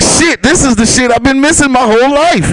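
high, delighted discovery; mock amazed. Finding wet naps in the store, with high mock amazement. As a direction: marvel at it like a revelation.

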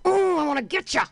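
high eager squeal; mock eager pounce. Comic chasing her runaway kitten, squealing eagerly. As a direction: lunge for the kitten with glee. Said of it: "and I'm like".

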